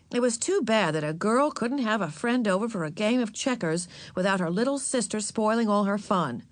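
ordinary delivery, nothing marked

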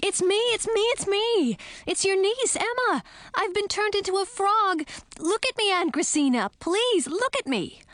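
high, pleading shout; shouting in frustration. A narrator's reading. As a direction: keep shouting, begging her to look. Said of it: "I shouted".

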